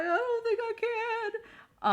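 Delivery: helpless high-pitched whine; acted panic. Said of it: "he's panicking".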